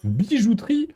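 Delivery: high, baffled echo of the word; incredulous rising question; surprised. Real, not acted. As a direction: repeat it in disbelief. Puzzled at shop names.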